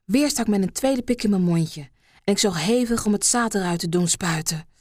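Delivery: ordinary delivery, nothing marked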